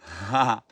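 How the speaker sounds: burst of laughter. Real, not acted. Laughs after joking about scaring people.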